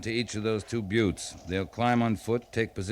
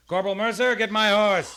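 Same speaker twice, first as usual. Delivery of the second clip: calling out; 10 semitones higher, 5 dB louder than usual. Acted. Captain calling an order.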